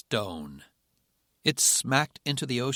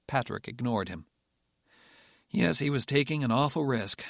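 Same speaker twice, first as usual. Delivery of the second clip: usual speaking voice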